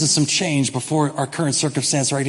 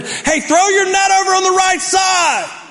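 high, loud shout across the water; shouted call from shore; 12 semitones higher, 4 dB louder than usual. Retold or imitated. Stranger on the shore shouting to the fishermen, loud high call. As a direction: yell it from the shore to the boat.

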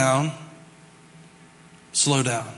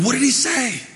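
high, louder panicked asking; frantic searching; 11 semitones higher, 4 dB louder than usual. Retold or imitated. Pastor acting out himself, frantic and high. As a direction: run around asking in a panic. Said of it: "I run around going".

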